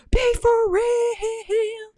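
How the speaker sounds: high, loud, drawn-out exasperation; be for real, slowly and high; exasperated. Real, not acted. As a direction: stretch it out in disbelief. Exasperated at herself.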